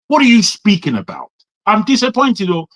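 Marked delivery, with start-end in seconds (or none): none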